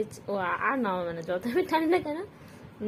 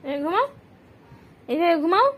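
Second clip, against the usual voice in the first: 1 loud coaxing; 6 semitones higher, 7 dB louder than usual. Real, not acted. Urging pet to sleep.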